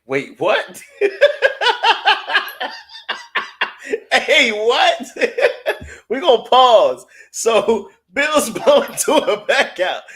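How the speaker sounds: high, loud, breaking into laughter; can't hold the laugh in; cracking up. Real, not acted. Reading a funny chat comment, laughing hard.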